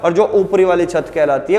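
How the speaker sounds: ordinary delivery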